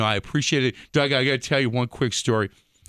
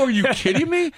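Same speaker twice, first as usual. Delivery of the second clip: mock disbelief; 13 semitones higher than usual. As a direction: play up the disbelief. Retold shock at a friend's news.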